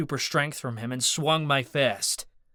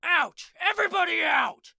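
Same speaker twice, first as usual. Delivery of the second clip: shouted. A narrator's reading. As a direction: bark them all out. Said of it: "then shouted".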